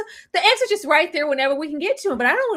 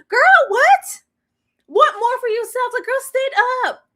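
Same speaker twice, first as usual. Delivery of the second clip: incredulous outburst; 11 semitones higher, 5 dB louder than usual. Real, not acted. Host pleading with Michelle, incredulous outburst. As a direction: cry out in disbelief.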